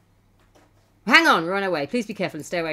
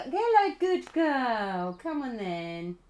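high cooing greeting; pet talk; 6 semitones higher, much slower than usual. Real, not acted. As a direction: coo to a kitten, sliding down from high. Cooing to her kitten.

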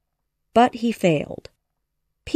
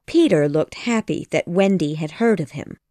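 plain reading voice

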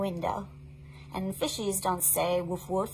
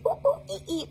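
much higher monkey ooh ee; high playful monkey calls; monkey hoots. Acted. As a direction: hoot like a monkey. Imitating monkey again.

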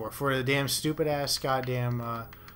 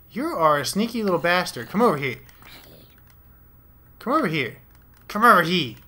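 raised, taunting shout at a sneaky mob; loud taunting call; 5 semitones higher, 8 dB louder than usual. Real, not acted. Gamer calls out to a hostile mob, loud taunt. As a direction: yell the mob over, getting louder each time.

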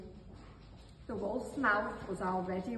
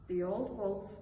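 ordinary delivery, nothing marked

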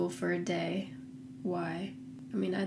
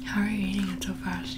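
whispering. Real, not acted. Repeating whispered question.

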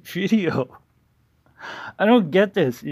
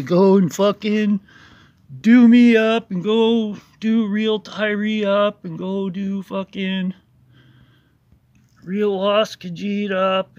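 mocking rant; 5 semitones higher, 7 dB louder than usual. Mocking rival's call to attack.

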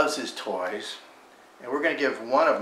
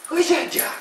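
very high and louder dog talk; excited squeal; 6 dB louder than usual. Real, not acted. Teasing the dog with toy.

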